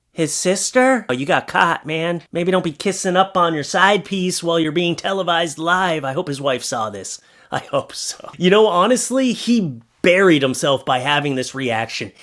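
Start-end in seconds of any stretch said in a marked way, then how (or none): 6.0-8.3 whispered aside. Real, not acted. Soft aside.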